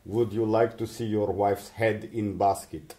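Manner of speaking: slow mock German mafia accent; menacing gangster with a German accent. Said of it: "That's not a bad German accent".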